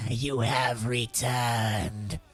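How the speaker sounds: very slow, low creature voice with a cough; huge old dragonborn. Acted. Quintessa welcomes the returned child, very slow low dragonborn voice. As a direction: draw every word out low and heavy.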